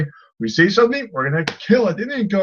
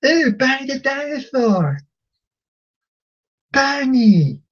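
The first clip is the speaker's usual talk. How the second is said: put-on high, delighted little-kid voice; mock childish wonder, pitched up; three-year-old boy; 7 semitones higher than usual. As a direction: mimic a toddler thrilled by the TV. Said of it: "He's, like, three years old".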